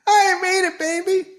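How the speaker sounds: high whiny woman voice; mock whiny reply. A host voices the woman answering her man, high and whiny. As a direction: whine it up high.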